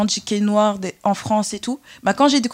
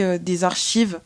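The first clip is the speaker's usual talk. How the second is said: normal talking voice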